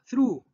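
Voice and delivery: "through" said as one syllable, THROO; English pronunciation demo. Shown as a demonstration. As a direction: say "through" with tongue between the teeth. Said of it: "vean la pronunciación".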